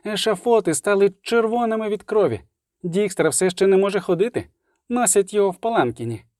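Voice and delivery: high-pitched bard telling news; bard's voice. Acted. Любисток briefing the witcher, high-pitched bard voice. Любисток figure in story dialogue.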